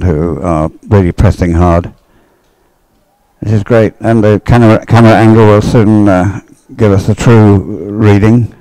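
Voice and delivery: ordinary talk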